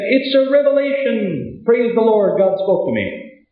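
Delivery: high, excited announcement, a touch hammed up; mock excited proclaiming. A man running back into the city, mock excited proclaiming. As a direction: cry out the news as if overjoyed.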